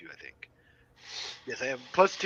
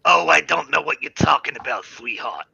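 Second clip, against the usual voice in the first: lightly voiced New England aunt, coy; a hint of an old New England aunt; denying it sweetly as a New England aunt; 5 semitones lower than usual. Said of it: "sounds like one of my aunts from New England".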